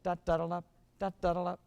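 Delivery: "Da-da-da-dup" with a firm pickup and hard second "da"; accented downbeat. Shown as a demonstration. Director demonstrating the downbeat accent, punched syllables. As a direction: kick off the first "Da" and hit the next. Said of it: "help you play the accent on the downbeat".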